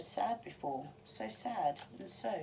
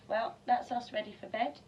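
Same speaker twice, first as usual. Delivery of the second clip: usual speaking voice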